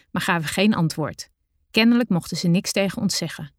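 ordinary delivery, nothing marked

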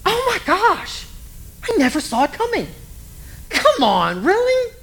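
high, slow mock shock; hammed-up gasp of disbelief; mock surprise. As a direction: act out the gossip's fake surprise. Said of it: "everybody says the same thing".

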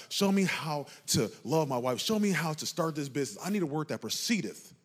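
preaching. Acted. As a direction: preach it to the back row. Preaching to the congregation.

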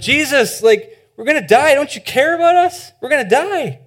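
high, panicked cry to a sleeping Jesus; frantic disciples shouting over the storm; panicked plea. Acted. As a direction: beg him to wake up, terrified.

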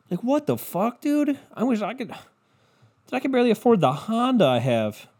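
high-pitched comic exasperation; exasperated disbelief. Acted. Comic who can barely afford his Honda, high exasperated disbelief. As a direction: pitch it up, incredulous. Exasperated at luxury car commercials.

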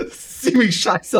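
slow, high laughing words. Real, not acted. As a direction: laugh it out slowly. Laughing at own humming.

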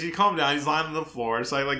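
usual speaking voice

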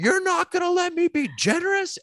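piercing high cry from the pews; old lady screaming. Retold or imitated. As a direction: shriek it like an outraged elderly churchgoer. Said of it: "she screams out".

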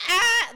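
loud, high startled "Ah!"; mock alarmed yell. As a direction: yelp like a panicked bodyguard. Retold Secret Service alarm.

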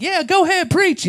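very high, loud egging-on; cheering on. Retold or imitated. Onlooker urging the preacher on, high excited cheer. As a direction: whoop it like a fan on the sidelines.

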